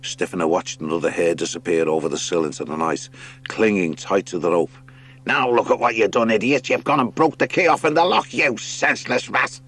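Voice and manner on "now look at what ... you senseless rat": a high, squabbling rat voice; scolding sea rat. Acted. Rip Fang berating his brother at the cell door, high and scornful. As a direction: tear into your dim brother.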